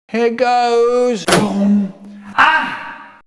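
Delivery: mock cry at the climax; high, drawn-out storyteller's exclamation; exclaiming. Storyteller acts out the painful blow, exclaiming.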